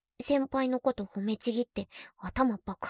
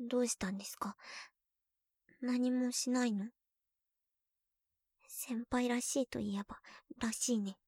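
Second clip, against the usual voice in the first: soft close whisper; soft intimate whisper to senpai; 6 dB quieter than usual. Acted. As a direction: draw him in quietly.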